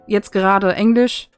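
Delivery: ordinary delivery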